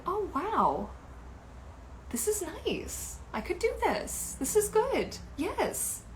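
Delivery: high, soft pleased wonder; quietly impressed. Retold or imitated. Recalling first putting the fin on, quietly impressed. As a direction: re-enact a delighted discovery in a high, soft voice. Acting out her first try-on reaction.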